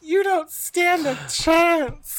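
high, slow sing-song taunt; mock taunt. Co-host taunting Conrad in a high slow voice. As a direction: tease him from up high.

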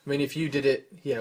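usual speaking voice

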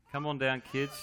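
ordinary talk, nothing marked

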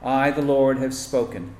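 ordinary delivery

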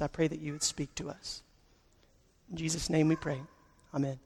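hushed, earnest prayer voice. Acted. Pastor praying aloud for the congregation, hushed and earnest. Pastor prays before sermon.

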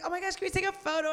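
fast, high, gushing fan request; excited fan. Retold or imitated. Drunk fan asking for a photo, in a fast, high gush. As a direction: rush it out like an eager fan. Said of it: "She's like".

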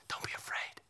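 "Don't be afraid" whispered, unvoiced and intimate; "Don't be afraid" as a tender whispered secret. Shown as a demonstration. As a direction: breathe "Don't be afraid" close to the ear. Whispered quote contrasted with preached.

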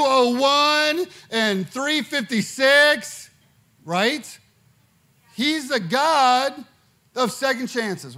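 loud, slow, high preaching; booming motivational speech. Acted. Preaching at full force.

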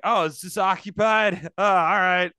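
a bear politely backing out of an occupied outhouse. Pretend. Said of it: "That bear absolutely was like".

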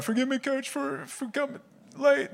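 high, weepy mock begging; mock tearful pleading. Player begging coach's forgiveness, mock tearful. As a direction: beg in a comic crying pitch. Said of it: "I just had tears streaming down my face".

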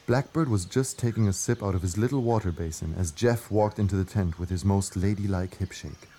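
usual speaking voice

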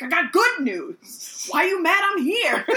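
mock-serious sass. Quoting the sassy character to co-hosts, hammed-up indignation. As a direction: act indignant for laughs. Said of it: "He's so serious".

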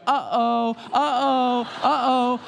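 put-on high, sing-song toddler uh-oh; dad imitating his toddler daughter, high sing-song uh-oh; little girl's uh-oh. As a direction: coo it like a small child watching chaos. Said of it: "And Autumn's just going".

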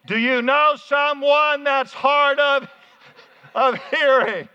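loud, high and drawn-out; loud, for the hard-of-hearing. Shown as a demonstration. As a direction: shout it slowly as if to someone hard of hearing. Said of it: "let me say it this way".

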